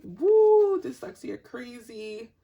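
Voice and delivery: high, loud whoop; excited whoop. Real, not acted. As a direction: let out a sudden high whoop. Reacting to her own dyslexia.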